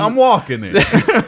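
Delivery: high, drawn-out mock New York accent; hammed-up New Yorker. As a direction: joke in a broad New York voice. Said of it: "someone with a New Yorker accent".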